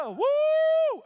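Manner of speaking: loud high whoop; high cheer. Real, not acted. Preacher whooping after forgiving, loud high cheer. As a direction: let out a big excited woo.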